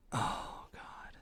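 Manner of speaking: whispered under the breath, real embarrassment; embarrassed whisper. Real, not acted. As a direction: breathe it out, mortified. Embarrassed after announcer bit.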